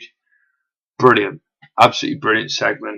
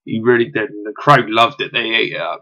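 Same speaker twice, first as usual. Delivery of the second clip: ordinary talk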